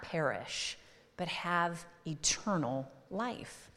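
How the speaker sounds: slow, deliberate reading of the verse; scripture reading. Acted. Pastor reading John 3:16 to the congregation, slow and solemn. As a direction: read it slowly, letting each phrase land.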